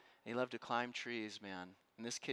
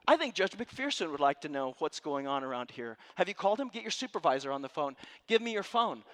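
loud, higher, demanding questions; commanding challenge; 7 semitones higher, 9 dB louder than usual. Retold or imitated. Lawyer grilling officials in the ER, loud and commanding. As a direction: press them hard, like a cross-examination. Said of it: "He said".

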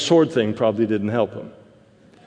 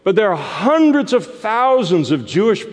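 rising, much higher, louder preacher's climax; preaching build; 8 semitones higher, 4 dB louder than usual. Real, not acted. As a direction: build it up to the crowd of pilgrims.

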